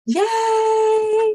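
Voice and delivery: loud-pitched, high squealed yay; excited cheer. Real, not acted. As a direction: let out a long, high cheer. Cheering the tour kickoff.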